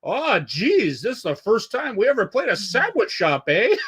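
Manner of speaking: put-on high, chirpy Quebec French-Canadian accent; imitating a nice Quebec singer's "Oh, geez... eh?". Retelling the Gore Guts singer's banter, high mock Quebec accent. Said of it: "in that Quebec accent".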